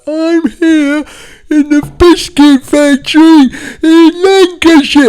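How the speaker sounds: high, loud, slow intense presenter voice; hammed-up intense TV host; Greg Wallace impression. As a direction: play the host far too intense. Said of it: "'cause he's really psychotic".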